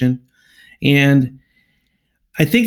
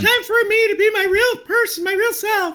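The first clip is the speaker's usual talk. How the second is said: squeaky high falsetto, played as his real voice; falsetto voice reveal. [name] reveals his real voice to TJ in a squeaky high falsetto. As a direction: reveal a tiny high voice with total sincerity. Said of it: "This is my real voice".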